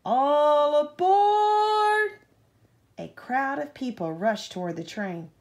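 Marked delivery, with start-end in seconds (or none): 0.0-2.2 very high, loud, slow sung-out call; conductor's shout. A narrator's reading. Conductor calls passengers to the train, long sung-out shout. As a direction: stretch it out like a conductor calling. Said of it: "the conductor shouted".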